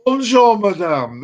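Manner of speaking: a hammed-up French hello; bad French accent. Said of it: "I can't do a French accent".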